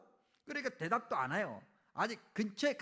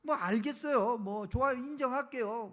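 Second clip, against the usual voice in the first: higher-pitched, needling concession; sarcastic acceptance; 4 semitones higher than usual. Acted. Prophet conceding to God's will, sarcastic and grudging. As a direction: throw God's own words back sarcastically. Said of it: "빈정되는 거예요".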